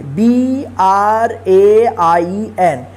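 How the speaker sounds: letters spelled out slowly one by one; slow spelling. Shown as a demonstration. Teacher spells word letter by letter.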